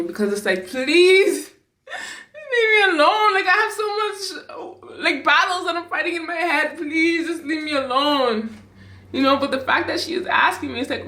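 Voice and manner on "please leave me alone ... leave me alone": high, whiny, exasperated pleading; annoyed plea. Retold or imitated. Overwhelmed daughter inwardly begging her mother to back off, annoyed. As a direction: beg to be left alone, voice pitched way up. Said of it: "I was a bit annoyed".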